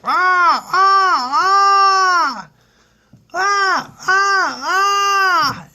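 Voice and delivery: loud, high monkey screeching; monkey screams. Retold or imitated. Uncle imitates noisy zoo monkeys, screeching loudly. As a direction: shriek in repeated bursts like a monkey. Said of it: "these monkeys are making these noises".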